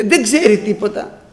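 normal talking voice

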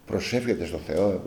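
low, hushed retold disbelief; quiet, low-pitched puzzled query; puzzled echo question. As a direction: repeat it softly, as if baffled. Retold baffled reply to friend.

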